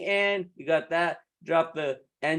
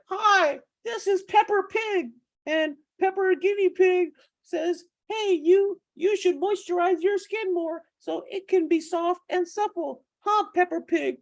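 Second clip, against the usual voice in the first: squeaky high guinea pig voice, much higher; Pepper Pig. Pretend. Coach voices the pet guinea pig in a video, high squeaky. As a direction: play the cartoon pet selling skincare. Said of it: "grab your phone and be like".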